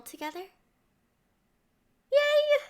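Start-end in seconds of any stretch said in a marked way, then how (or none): 2.1-2.6 high, loud squeal of joy; gleeful cheer; excited yay. Acted. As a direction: burst out delighted. Princess thrilled at stroll invite.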